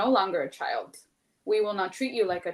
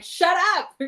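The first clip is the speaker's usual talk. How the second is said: sharp high yell, much louder; snapping at kids; 9 semitones higher, 9 dB louder than usual. Retold or imitated. Mom in the car snapping at bickering kids, loud yell. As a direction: snap it out over the bickering. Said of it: "And I'm like".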